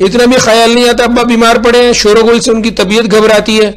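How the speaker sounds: put-on high, loud scolding of the child; scolding mother. A narrator's reading. Mother shouting at her son over noise.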